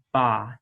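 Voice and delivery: "ba" with a clear B between open vowels; demo syllable ba. Shown as a demonstration. Teacher to students, demonstrating the B sound clearly. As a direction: say "ba" slowly, B sound in the middle. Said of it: "it's the B sound in the middle".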